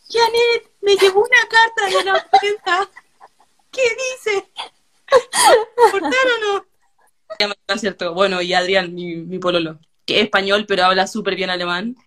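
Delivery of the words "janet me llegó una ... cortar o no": put-on much higher, quavering old woman on the edge of tears; old lady voice. Herself as an old woman asking her friend for help, quavering and teary. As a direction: play yourself at eighty, fretful and teary. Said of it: "Me tienes que durar hasta viejita".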